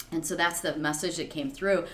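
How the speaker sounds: normal talking voice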